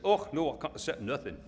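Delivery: put-on Scottish farmer's accent. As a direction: refuse it like a Scot, in broad Scottish accent. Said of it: "That's a Scottish accent".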